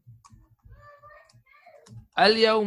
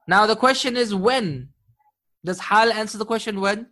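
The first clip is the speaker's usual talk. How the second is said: ordinary talk, nothing marked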